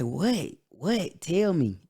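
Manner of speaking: retold urgent questions, pitched high; urgent demand. Begging grandma to tell the news, urgent.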